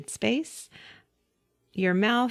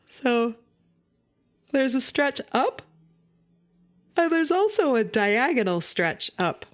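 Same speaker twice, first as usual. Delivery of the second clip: yawning; 4 semitones higher than usual. Shown as a demonstration. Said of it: "If you yawn really big".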